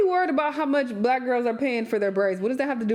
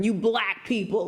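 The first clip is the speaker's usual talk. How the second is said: mock bigot. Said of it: "that can be triggering".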